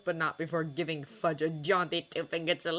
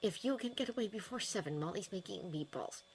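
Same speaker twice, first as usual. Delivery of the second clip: whispered; 8 dB quieter than usual. A narrator's reading. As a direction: slip a secret invitation to a colleague close by. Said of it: "he leaned closer and whispered".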